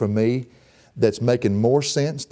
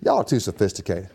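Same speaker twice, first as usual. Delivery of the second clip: ordinary delivery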